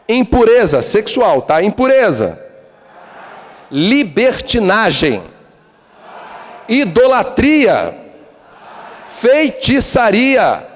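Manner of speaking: each sin drawn out high and slow; slow emphatic list. Acted. Preacher reading the works of the flesh, slow and weighty. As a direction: pronounce each sin like a verdict.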